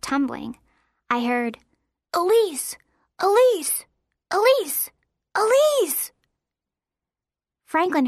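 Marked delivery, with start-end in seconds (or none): 2.1-6.1 high, urgent cries of a name; calling out. A narrator's reading. Boy hurries down the hill after his fallen friend, urgent high calls. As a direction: call the name over and over, climbing higher.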